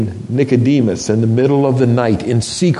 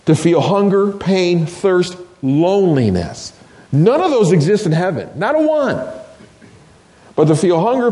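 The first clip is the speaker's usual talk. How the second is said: impassioned preaching, rising and falling; 6 semitones higher than usual. Acted. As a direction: preach it with full conviction. Preacher building up to climax.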